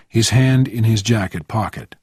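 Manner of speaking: plain reading voice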